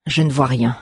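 understated, resigned sigh. A narrator's reading. Magistel finding nothing on the walls, mild sighing. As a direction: say it with a slight sigh. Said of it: "soupira le magistel".

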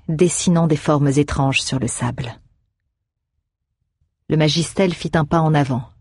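ordinary delivery

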